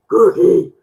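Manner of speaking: low rough monster saying "Cookie". Pretend. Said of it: "in Cookie Monster's voice".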